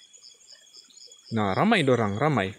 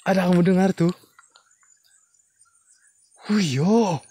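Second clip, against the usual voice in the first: higher, louder urgent question to the viewers; alarmed call; 6 semitones higher, 5 dB louder than usual. Real, not acted. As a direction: ask them again, getting scared. Alarmed asking viewers about noise.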